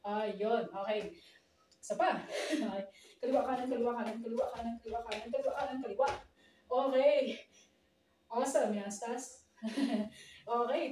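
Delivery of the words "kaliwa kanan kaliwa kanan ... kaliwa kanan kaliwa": rapid-fire step count with hardly a gap; speedy dance drill; fast count. Shown as a demonstration. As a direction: rattle off the steps in one run. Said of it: "mas mabilis".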